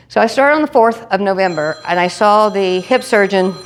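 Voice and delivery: ordinary delivery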